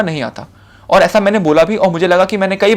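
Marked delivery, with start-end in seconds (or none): 0.0-0.5 नहीं आता said softly, lower, falling on नहीं; quiet admission. Shown as a demonstration. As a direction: let नहीं आता drop to a quiet honest fall. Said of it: "नहीं आता बोलूँगा".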